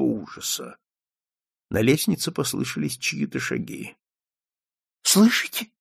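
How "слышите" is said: high, frightened moan. A narrator's reading. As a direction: moan it in dread. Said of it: "простонал он".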